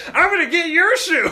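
high, loud laughing tough-guy; playful gruff cop taunt; 1930s beat cop. Retold or imitated. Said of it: "And he's like".

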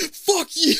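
high, loud yell at the bats. Pretend. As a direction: scream it at the bats. Yelling at bats.

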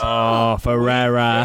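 slow, English-sounding show title call; mock announcer echo. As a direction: echo the grand title, drawing out the name. Echoing the show's title call.

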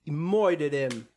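"moided" said with "oi" in place of "ur"; mock pronunciation. Reacting to Han's killing, comic "oi" in "moided". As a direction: drag out "moided" slowly, with a comic oi vowel.

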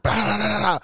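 rapid rattling bang noises; imitated firecracker burst.

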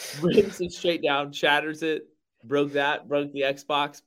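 normal talking voice

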